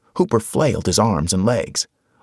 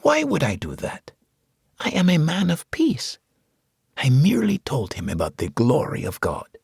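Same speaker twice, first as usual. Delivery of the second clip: put-on patient, as to a child; 9 semitones higher than usual. A narrator's reading. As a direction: reassure him like a small child. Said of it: "as if speaking to a child".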